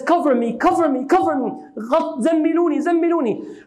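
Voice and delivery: high, urgent plea to be covered, voice climbing; frightened plea. Retold or imitated. A terrified husband begging his wife, Cover me, in a high urgent voice. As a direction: cry out for cover like a shaken man.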